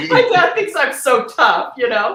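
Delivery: loud, high laughing talk. Real, not acted. Telling the interviewer her dad thinks she's tough, laughing.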